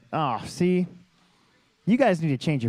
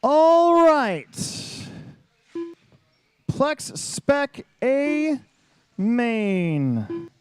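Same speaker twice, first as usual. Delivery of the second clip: hype announcer; 7 semitones higher, much slower than usual. Real, not acted. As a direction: belt it like a stadium announcer. Hyping the main event start.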